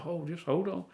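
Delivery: quiet retold coaxing; hushed steadying. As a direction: gently hush an eager hunter.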